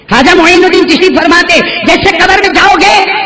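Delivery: loud impassioned sermon climax; preaching at full force. Real, not acted. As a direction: thunder it from the pulpit.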